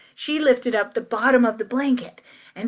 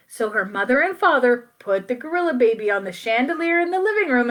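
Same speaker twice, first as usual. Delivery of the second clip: plain reading voice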